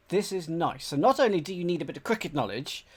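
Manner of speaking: ordinary delivery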